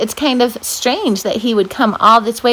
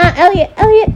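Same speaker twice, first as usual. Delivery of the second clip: calling out to dog; 10 semitones higher, 4 dB louder, faster than usual. Real, not acted. Scolding the dog.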